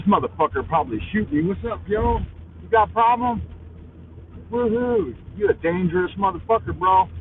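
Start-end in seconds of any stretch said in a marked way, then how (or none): 4.5-5.1 sudden high falsetto whoop; excited whoop. Real, not acted. To the aggressive driver, a high whoop. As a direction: let out a high mock cheer.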